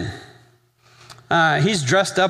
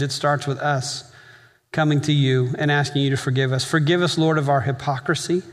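closing prayer. Acted. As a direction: pray over the congregation with long pauses. Leads the congregation in prayer.

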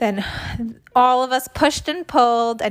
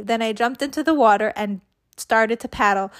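ordinary delivery

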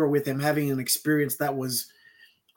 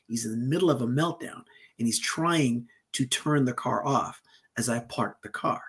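softer, steady, calm explaining; calm explanation; 3 semitones lower, 6 dB quieter than usual. Retold or imitated. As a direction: lower the temperature and explain gently. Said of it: "calmly, I said".

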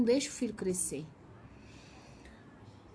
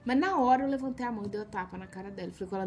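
ordinary delivery

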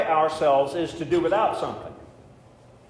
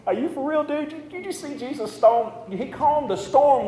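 incredulous; 10 semitones higher than usual. Acted. Mock disbelief at rebuking Jesus.

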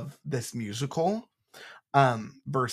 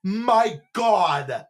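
much higher, loud, stunned; shocked gasp; 10 semitones higher, 6 dB louder, much slower than usual. Real, not acted. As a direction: gasp in real disbelief at the news. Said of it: "Okay, sorry".

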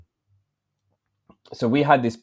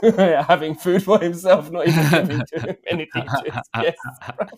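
words breaking up with laughter, higher-pitched; helpless laughter; 6 semitones higher, 6 dB louder than usual. Real, not acted. Host imagining guests judging his manners, laughing hard. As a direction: laugh through the mock accusation.

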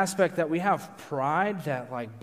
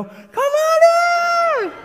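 very high, drawn-out holler; calling out; 11 dB louder, much slower than usual. Retold or imitated. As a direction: holler it like a wife from the kitchen window. Wife calls from kitchen window.

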